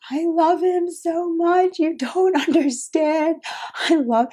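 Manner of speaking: high, slow, exaggerated sobbing plea; mock drunk crying. Telling viewers how she wailed about loving him, mock crying. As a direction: ham up a drunk girl wailing her love. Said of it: "I just remember crying, like".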